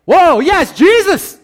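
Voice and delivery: very high, loud whoop; excited cheer. Retold or imitated. Preacher voicing a believer fired up after meeting God, loud whoop. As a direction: cheer like a fan at a concert. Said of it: "and it's like".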